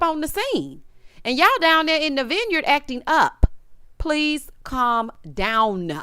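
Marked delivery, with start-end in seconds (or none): 4.0-5.9 very slow, drawn-out calm down; slow plea. Real, not acted. Host begging the cast to settle, very slow. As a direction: stretch every word.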